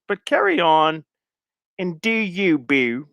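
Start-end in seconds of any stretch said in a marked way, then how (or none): 1.8-3.1 put-on half-hearted Baltimore accent; attempted Baltimore accent. Streamer signing off the quoted post, rough Baltimore accent. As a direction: have a light go at a Baltimore accent. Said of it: "in my Baltimore accent".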